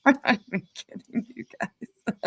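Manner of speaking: words breaking up into laughter; laughing. Real, not acted. Breaks into laughter while talking.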